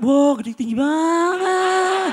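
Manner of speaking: very slow, drawn-out wow; slow awed country bumpkin; mock awe. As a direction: gawk like a village kid. Awed newcomer.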